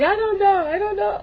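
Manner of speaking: high, laughing through the words; helpless giggly squeal. Real, not acted. Unsure what to call it, laughing high.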